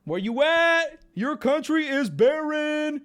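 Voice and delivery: high, loud, drawn-out holler; mock calling out. Streamer calling out to Icelandic homies, mock yelling. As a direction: holler it slowly as if across a crowd.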